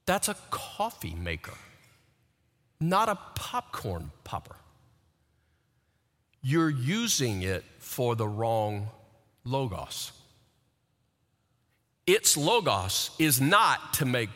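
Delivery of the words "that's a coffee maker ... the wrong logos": gentle, soft correction; kind words. Retold or imitated. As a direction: correct them kindly and quietly. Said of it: "with kind words say".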